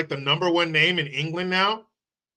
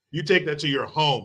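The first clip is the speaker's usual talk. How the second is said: ordinary talk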